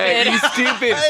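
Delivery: giggly high teasing; laughing mock taunt. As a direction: tease through laughter.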